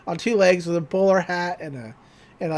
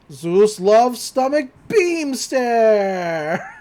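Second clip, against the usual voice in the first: very slow, drawn-out attack call; announcing attack; 3 semitones higher, much slower than usual. Retold or imitated. Zeus calling his Care Bears attack, very slow. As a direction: stretch every word like a move name.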